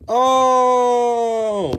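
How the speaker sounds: loud, high, held oh; loud surprised oh; sudden realization. Real, not acted. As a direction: it just clicked.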